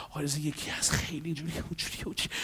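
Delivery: very soft imitated gossip; hushed confiding. Client confiding about another person, hushed. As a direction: trail along in a near whisper.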